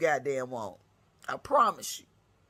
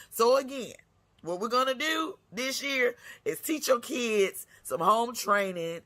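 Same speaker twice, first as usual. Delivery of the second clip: usual speaking voice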